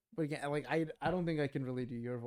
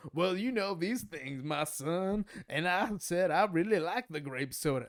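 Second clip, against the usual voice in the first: slow, higher-pitched hammed-up drawl; 5 semitones higher, 4 dB louder, slower than usual. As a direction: stretch it out as a mocking caricature.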